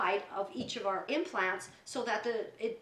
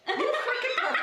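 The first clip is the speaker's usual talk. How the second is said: high, loud laughing accusation; indignant mock outburst; 14 semitones higher, 5 dB louder than usual. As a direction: hurl it out in mock outrage, through laughter. Retold outburst at the surgeon.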